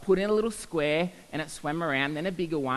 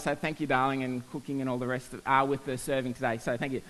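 warm thanks; 6 semitones lower, 5 dB quieter than usual. Real, not acted. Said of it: "my wife's very good".

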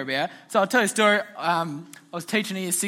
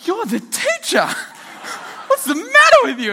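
much higher, loud mock outrage rising to a yell; outraged class; 7 dB louder than usual. Students protesting the teacher's snarky reply in mock outrage. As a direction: shriek it like shocked kids ganging up on the teacher. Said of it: "And the whole class goes".

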